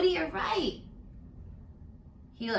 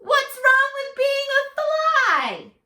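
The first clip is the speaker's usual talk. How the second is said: high sung little fly voice; a cheeky fly chiming in; tiny high insect voice; sing-song fly; 13 semitones higher, 4 dB louder than usual. Acted. Fly character sings its question.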